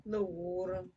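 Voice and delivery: "Little water" low, with a stretched "water"; drawled pronunciation. Shown as a demonstration. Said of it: "You gotta say it like that".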